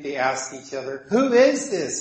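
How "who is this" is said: higher, louder astonished question; fearful amazement. Retold or imitated. Disciples asking each other, amazed and a little afraid. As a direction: ask it in mildly acted awe. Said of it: "in fear and amazement".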